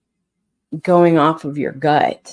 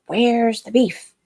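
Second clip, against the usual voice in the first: higher, slow, drawn-out commercial catchphrase; higher, slow imitation of the burger ad line; Where's the beef lady; 7 semitones higher, slower than usual. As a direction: quote the old ad lady, stretching each word. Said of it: "Burger King, where she's like".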